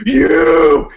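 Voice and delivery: sudden high, loud shout of You!; chainsaw clown yell. Retold or imitated. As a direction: lunge at them with one barked You!.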